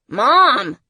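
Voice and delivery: loud, high cry after her; urgent son's yell; alarmed call. A narrator's reading. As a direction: shout to stop her leaving. Son calls as mother jumps out.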